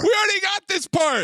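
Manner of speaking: high-pitched mock exasperation; exasperated protest. Viewer interrupting redundant voiceover, high exasperated protest. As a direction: interrupt in high, indignant disbelief.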